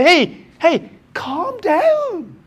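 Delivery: hammed-up frantic calm down; mock frantic calm down. Mocking worked-up Christians.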